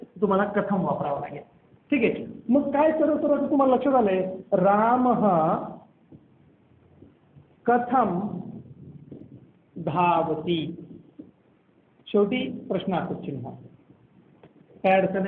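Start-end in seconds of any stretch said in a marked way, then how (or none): 4.5-10.8 word by word with long pauses; drawn-out syllables, as if writing along; slow dictation. Shown as a demonstration. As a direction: dictate it very slowly, pausing after each word. Dictating the question while writing it.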